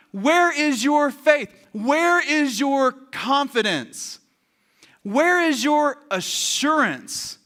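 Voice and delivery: high, slow, ringing questions; emphatic challenge. Acted. Jesus challenging the panicking disciples, emphatic challenge. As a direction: press each question slowly and forcefully.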